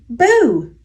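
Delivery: plain reading voice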